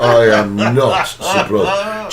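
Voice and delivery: I am not surprised, drawn out slowly; slow emphatic. Real, not acted. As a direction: lean on each word, slowly. Repeating remark slowly for emphasis.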